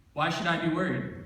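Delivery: ordinary delivery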